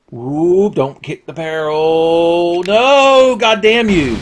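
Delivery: drawn-out rising shout of dismay; real yell at the game, high and loud; frustrated yell. Real, not acted. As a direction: stretch it out, building to a loud high cry. Yelling at enemy kicking the barrel.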